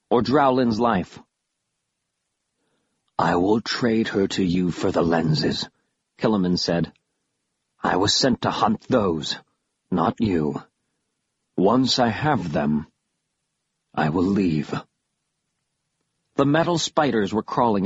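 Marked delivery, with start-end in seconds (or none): 3.2-5.7 slow, lower villain voice; cold deal-maker, low and drawn out; menacing creature. A narrator's reading. Killiman offers to trade her for the lenses, low menacing creature. 7.8-14.7 slow, lower villain voice; cold deal-maker, low and drawn out; menacing creature. A narrator's reading. Killiman offers to trade her for the lenses, low menacing creature.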